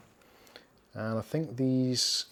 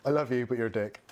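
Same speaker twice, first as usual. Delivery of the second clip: normal talking voice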